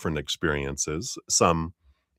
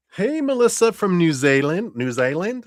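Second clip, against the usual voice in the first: excited greeting; 13 semitones higher, 5 dB louder than usual. Real, not acted. Greeting a viewer in chat.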